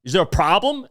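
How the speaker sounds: hectoring mock tirade; imitating angry boss. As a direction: act out a boss dressing someone down. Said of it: "just yell at people".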